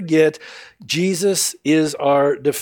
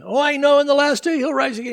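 breezy Martha; 11 semitones higher, faster than usual. Acted. Martha answers Jesus breezily.

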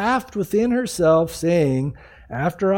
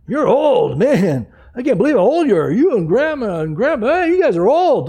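louder, lively sing-song teasing; mock tactless amazement; 4 semitones higher, 4 dB louder than usual. Tactless grandkid gawking at grandparents' age, mock amazement. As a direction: marvel bluntly, louder and higher by the end.

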